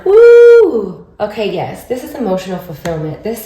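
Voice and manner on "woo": a loud, high cheer of delight; excited woo. Real, not acted. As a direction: cheer as the good card turns up. Excited at drawing the Sun card.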